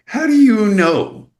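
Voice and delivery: high-pitched, drawn-out exasperated disbelief; incredulous. Acted. Actor challenging producers who claim to know a star's schedule, incredulous.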